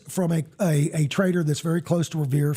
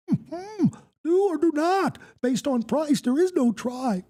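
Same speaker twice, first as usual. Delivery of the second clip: high-pitched creature voice, far above the usual; Yoda; 9 semitones higher than usual. Acted. Host redoing a nickname's catchphrase, in a high Yoda voice. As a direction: croak out the lines as a high little Jedi master. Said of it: "I need to do that in my Yoda voice".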